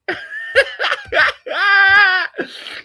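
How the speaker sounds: high, loud laugh breaking into a cry; despairing laugh-cry. Real, not acted. Fan watching his team fail, laughing and crying at once. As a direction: laugh in disbelief that turns to anguish.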